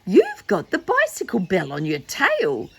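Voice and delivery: high, loud teasing character voice; mock-laughing tease. Sally Skittle teasing about the bicycle bell, high mock-amused voice. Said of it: "she was laughing and saying".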